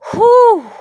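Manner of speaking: high, loud falling "whew"; loud venting whoop; exasperated whoop. Real, not acted. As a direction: let the rage out in one hoot. Venting anger between rants.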